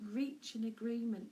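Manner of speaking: ordinary talk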